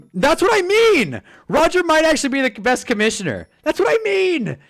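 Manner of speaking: high, loud excited exclamation; real excitement, voice shooting up; excited agreement. Real, not acted. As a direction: burst out in emphatic, high-pitched agreement. Emphatically seizing on a viewer's agreement.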